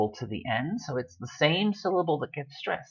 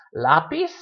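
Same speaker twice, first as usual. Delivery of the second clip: lápiz stressed as LA-piz; stress on first syllable; 3 semitones higher, 3 dB louder than usual. Shown as a demonstration. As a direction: lean on the LA of lápiz. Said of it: "It's lápiz".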